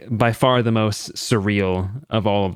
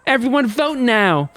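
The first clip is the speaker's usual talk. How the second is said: high, showy appeal to the crowd; rally the audience against the accused; gleeful show host; 9 semitones higher than usual. Acted. Host asks the audience to vote on Boy A, theatrical.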